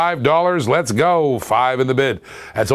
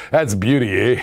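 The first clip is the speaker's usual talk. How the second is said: lower, hammed-up Canadian "beauty, eh" again; Canadian slang; 6 semitones lower than usual. Seller repeating the most Canadian phrase, mock Canadian accent. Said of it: "most Canadian thing you can say".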